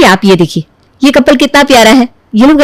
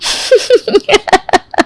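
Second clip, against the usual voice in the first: high, softer burst of giggles; giggling laugh; 8 semitones higher, 5 dB quieter than usual. Acted. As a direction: laugh helplessly at an absurd idea. Reaction to absurd gene plan.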